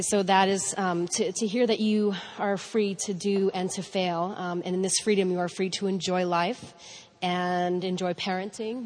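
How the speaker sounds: ordinary talk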